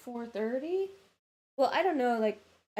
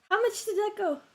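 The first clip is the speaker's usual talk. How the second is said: laughing while asking, higher; 9 semitones higher, 4 dB louder than usual. Real, not acted. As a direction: question through a laugh. Laughing at the long book.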